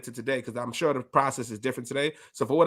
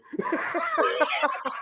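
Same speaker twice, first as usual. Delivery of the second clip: high laugh; 14 semitones higher than usual. Real, not acted. Host laughs after joke.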